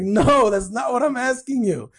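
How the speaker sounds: losing it. Retold or imitated. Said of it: "like, losing it".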